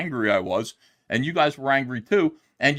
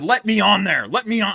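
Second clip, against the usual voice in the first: retold angry shout, higher and louder; angry demand; 9 semitones higher, 7 dB louder than usual. Audience member demands to be let on the stream, angry shout. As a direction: bang on the door to be let on the show. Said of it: "I got very, very angry".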